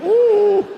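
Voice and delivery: high sad whimpering ooh; a high, mock-sad ooh; pitiful dog whimper. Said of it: "he's like".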